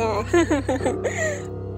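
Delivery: slow villainous chuckle; evil laugh. Acted. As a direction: gloat like a devil. Devil gloats over his condition. (শয়তানি হাসি)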